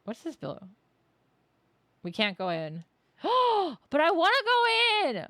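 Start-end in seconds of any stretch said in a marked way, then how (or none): 3.2-3.9 sharp gasp. Real, not acted. Locked door reaction. 3.9-5.2 whiny protest. Real, not acted. Streamer refused entry, loud whiny protest. Whining at locked door.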